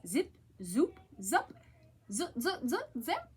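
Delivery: playful zipper noises, bouncing; zipping sounds. A narrator's reading. Reading to children, playful zipper sounds.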